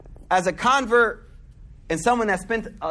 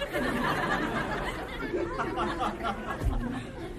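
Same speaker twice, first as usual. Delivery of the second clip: breathy burst of laughter; real laughter; 2 semitones higher, 10 dB quieter than usual. Real, not acted. Preacher cracks up at his own story, laughing.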